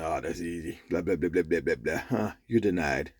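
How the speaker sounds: low, soft, offhand gatekeeper voice; breezy dismissal. Retold or imitated. Gatekeeper turning a man away from heaven, acted offhand dismissal. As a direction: rattle off the answer low and unimpressed.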